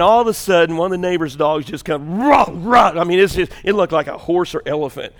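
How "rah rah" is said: loud, high mock barking; dog bark imitation.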